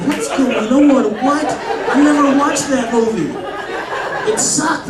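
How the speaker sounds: high-pitched, hammed-up panic; mock panic. Kid getting dad's scary prank call, mock panic.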